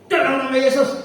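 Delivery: reenacted exorcism command. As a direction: order the demon out with force. Retold command casting out demon.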